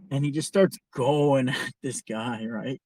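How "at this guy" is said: usual speaking voice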